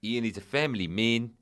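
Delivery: stern South African agent defending his client; South African accent. Acted. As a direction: lay down the law in a put-on South African accent. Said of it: "This is what he said".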